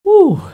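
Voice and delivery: very high loud whoop of relief; relieved whoop. Real, not acted. Waking up relieved.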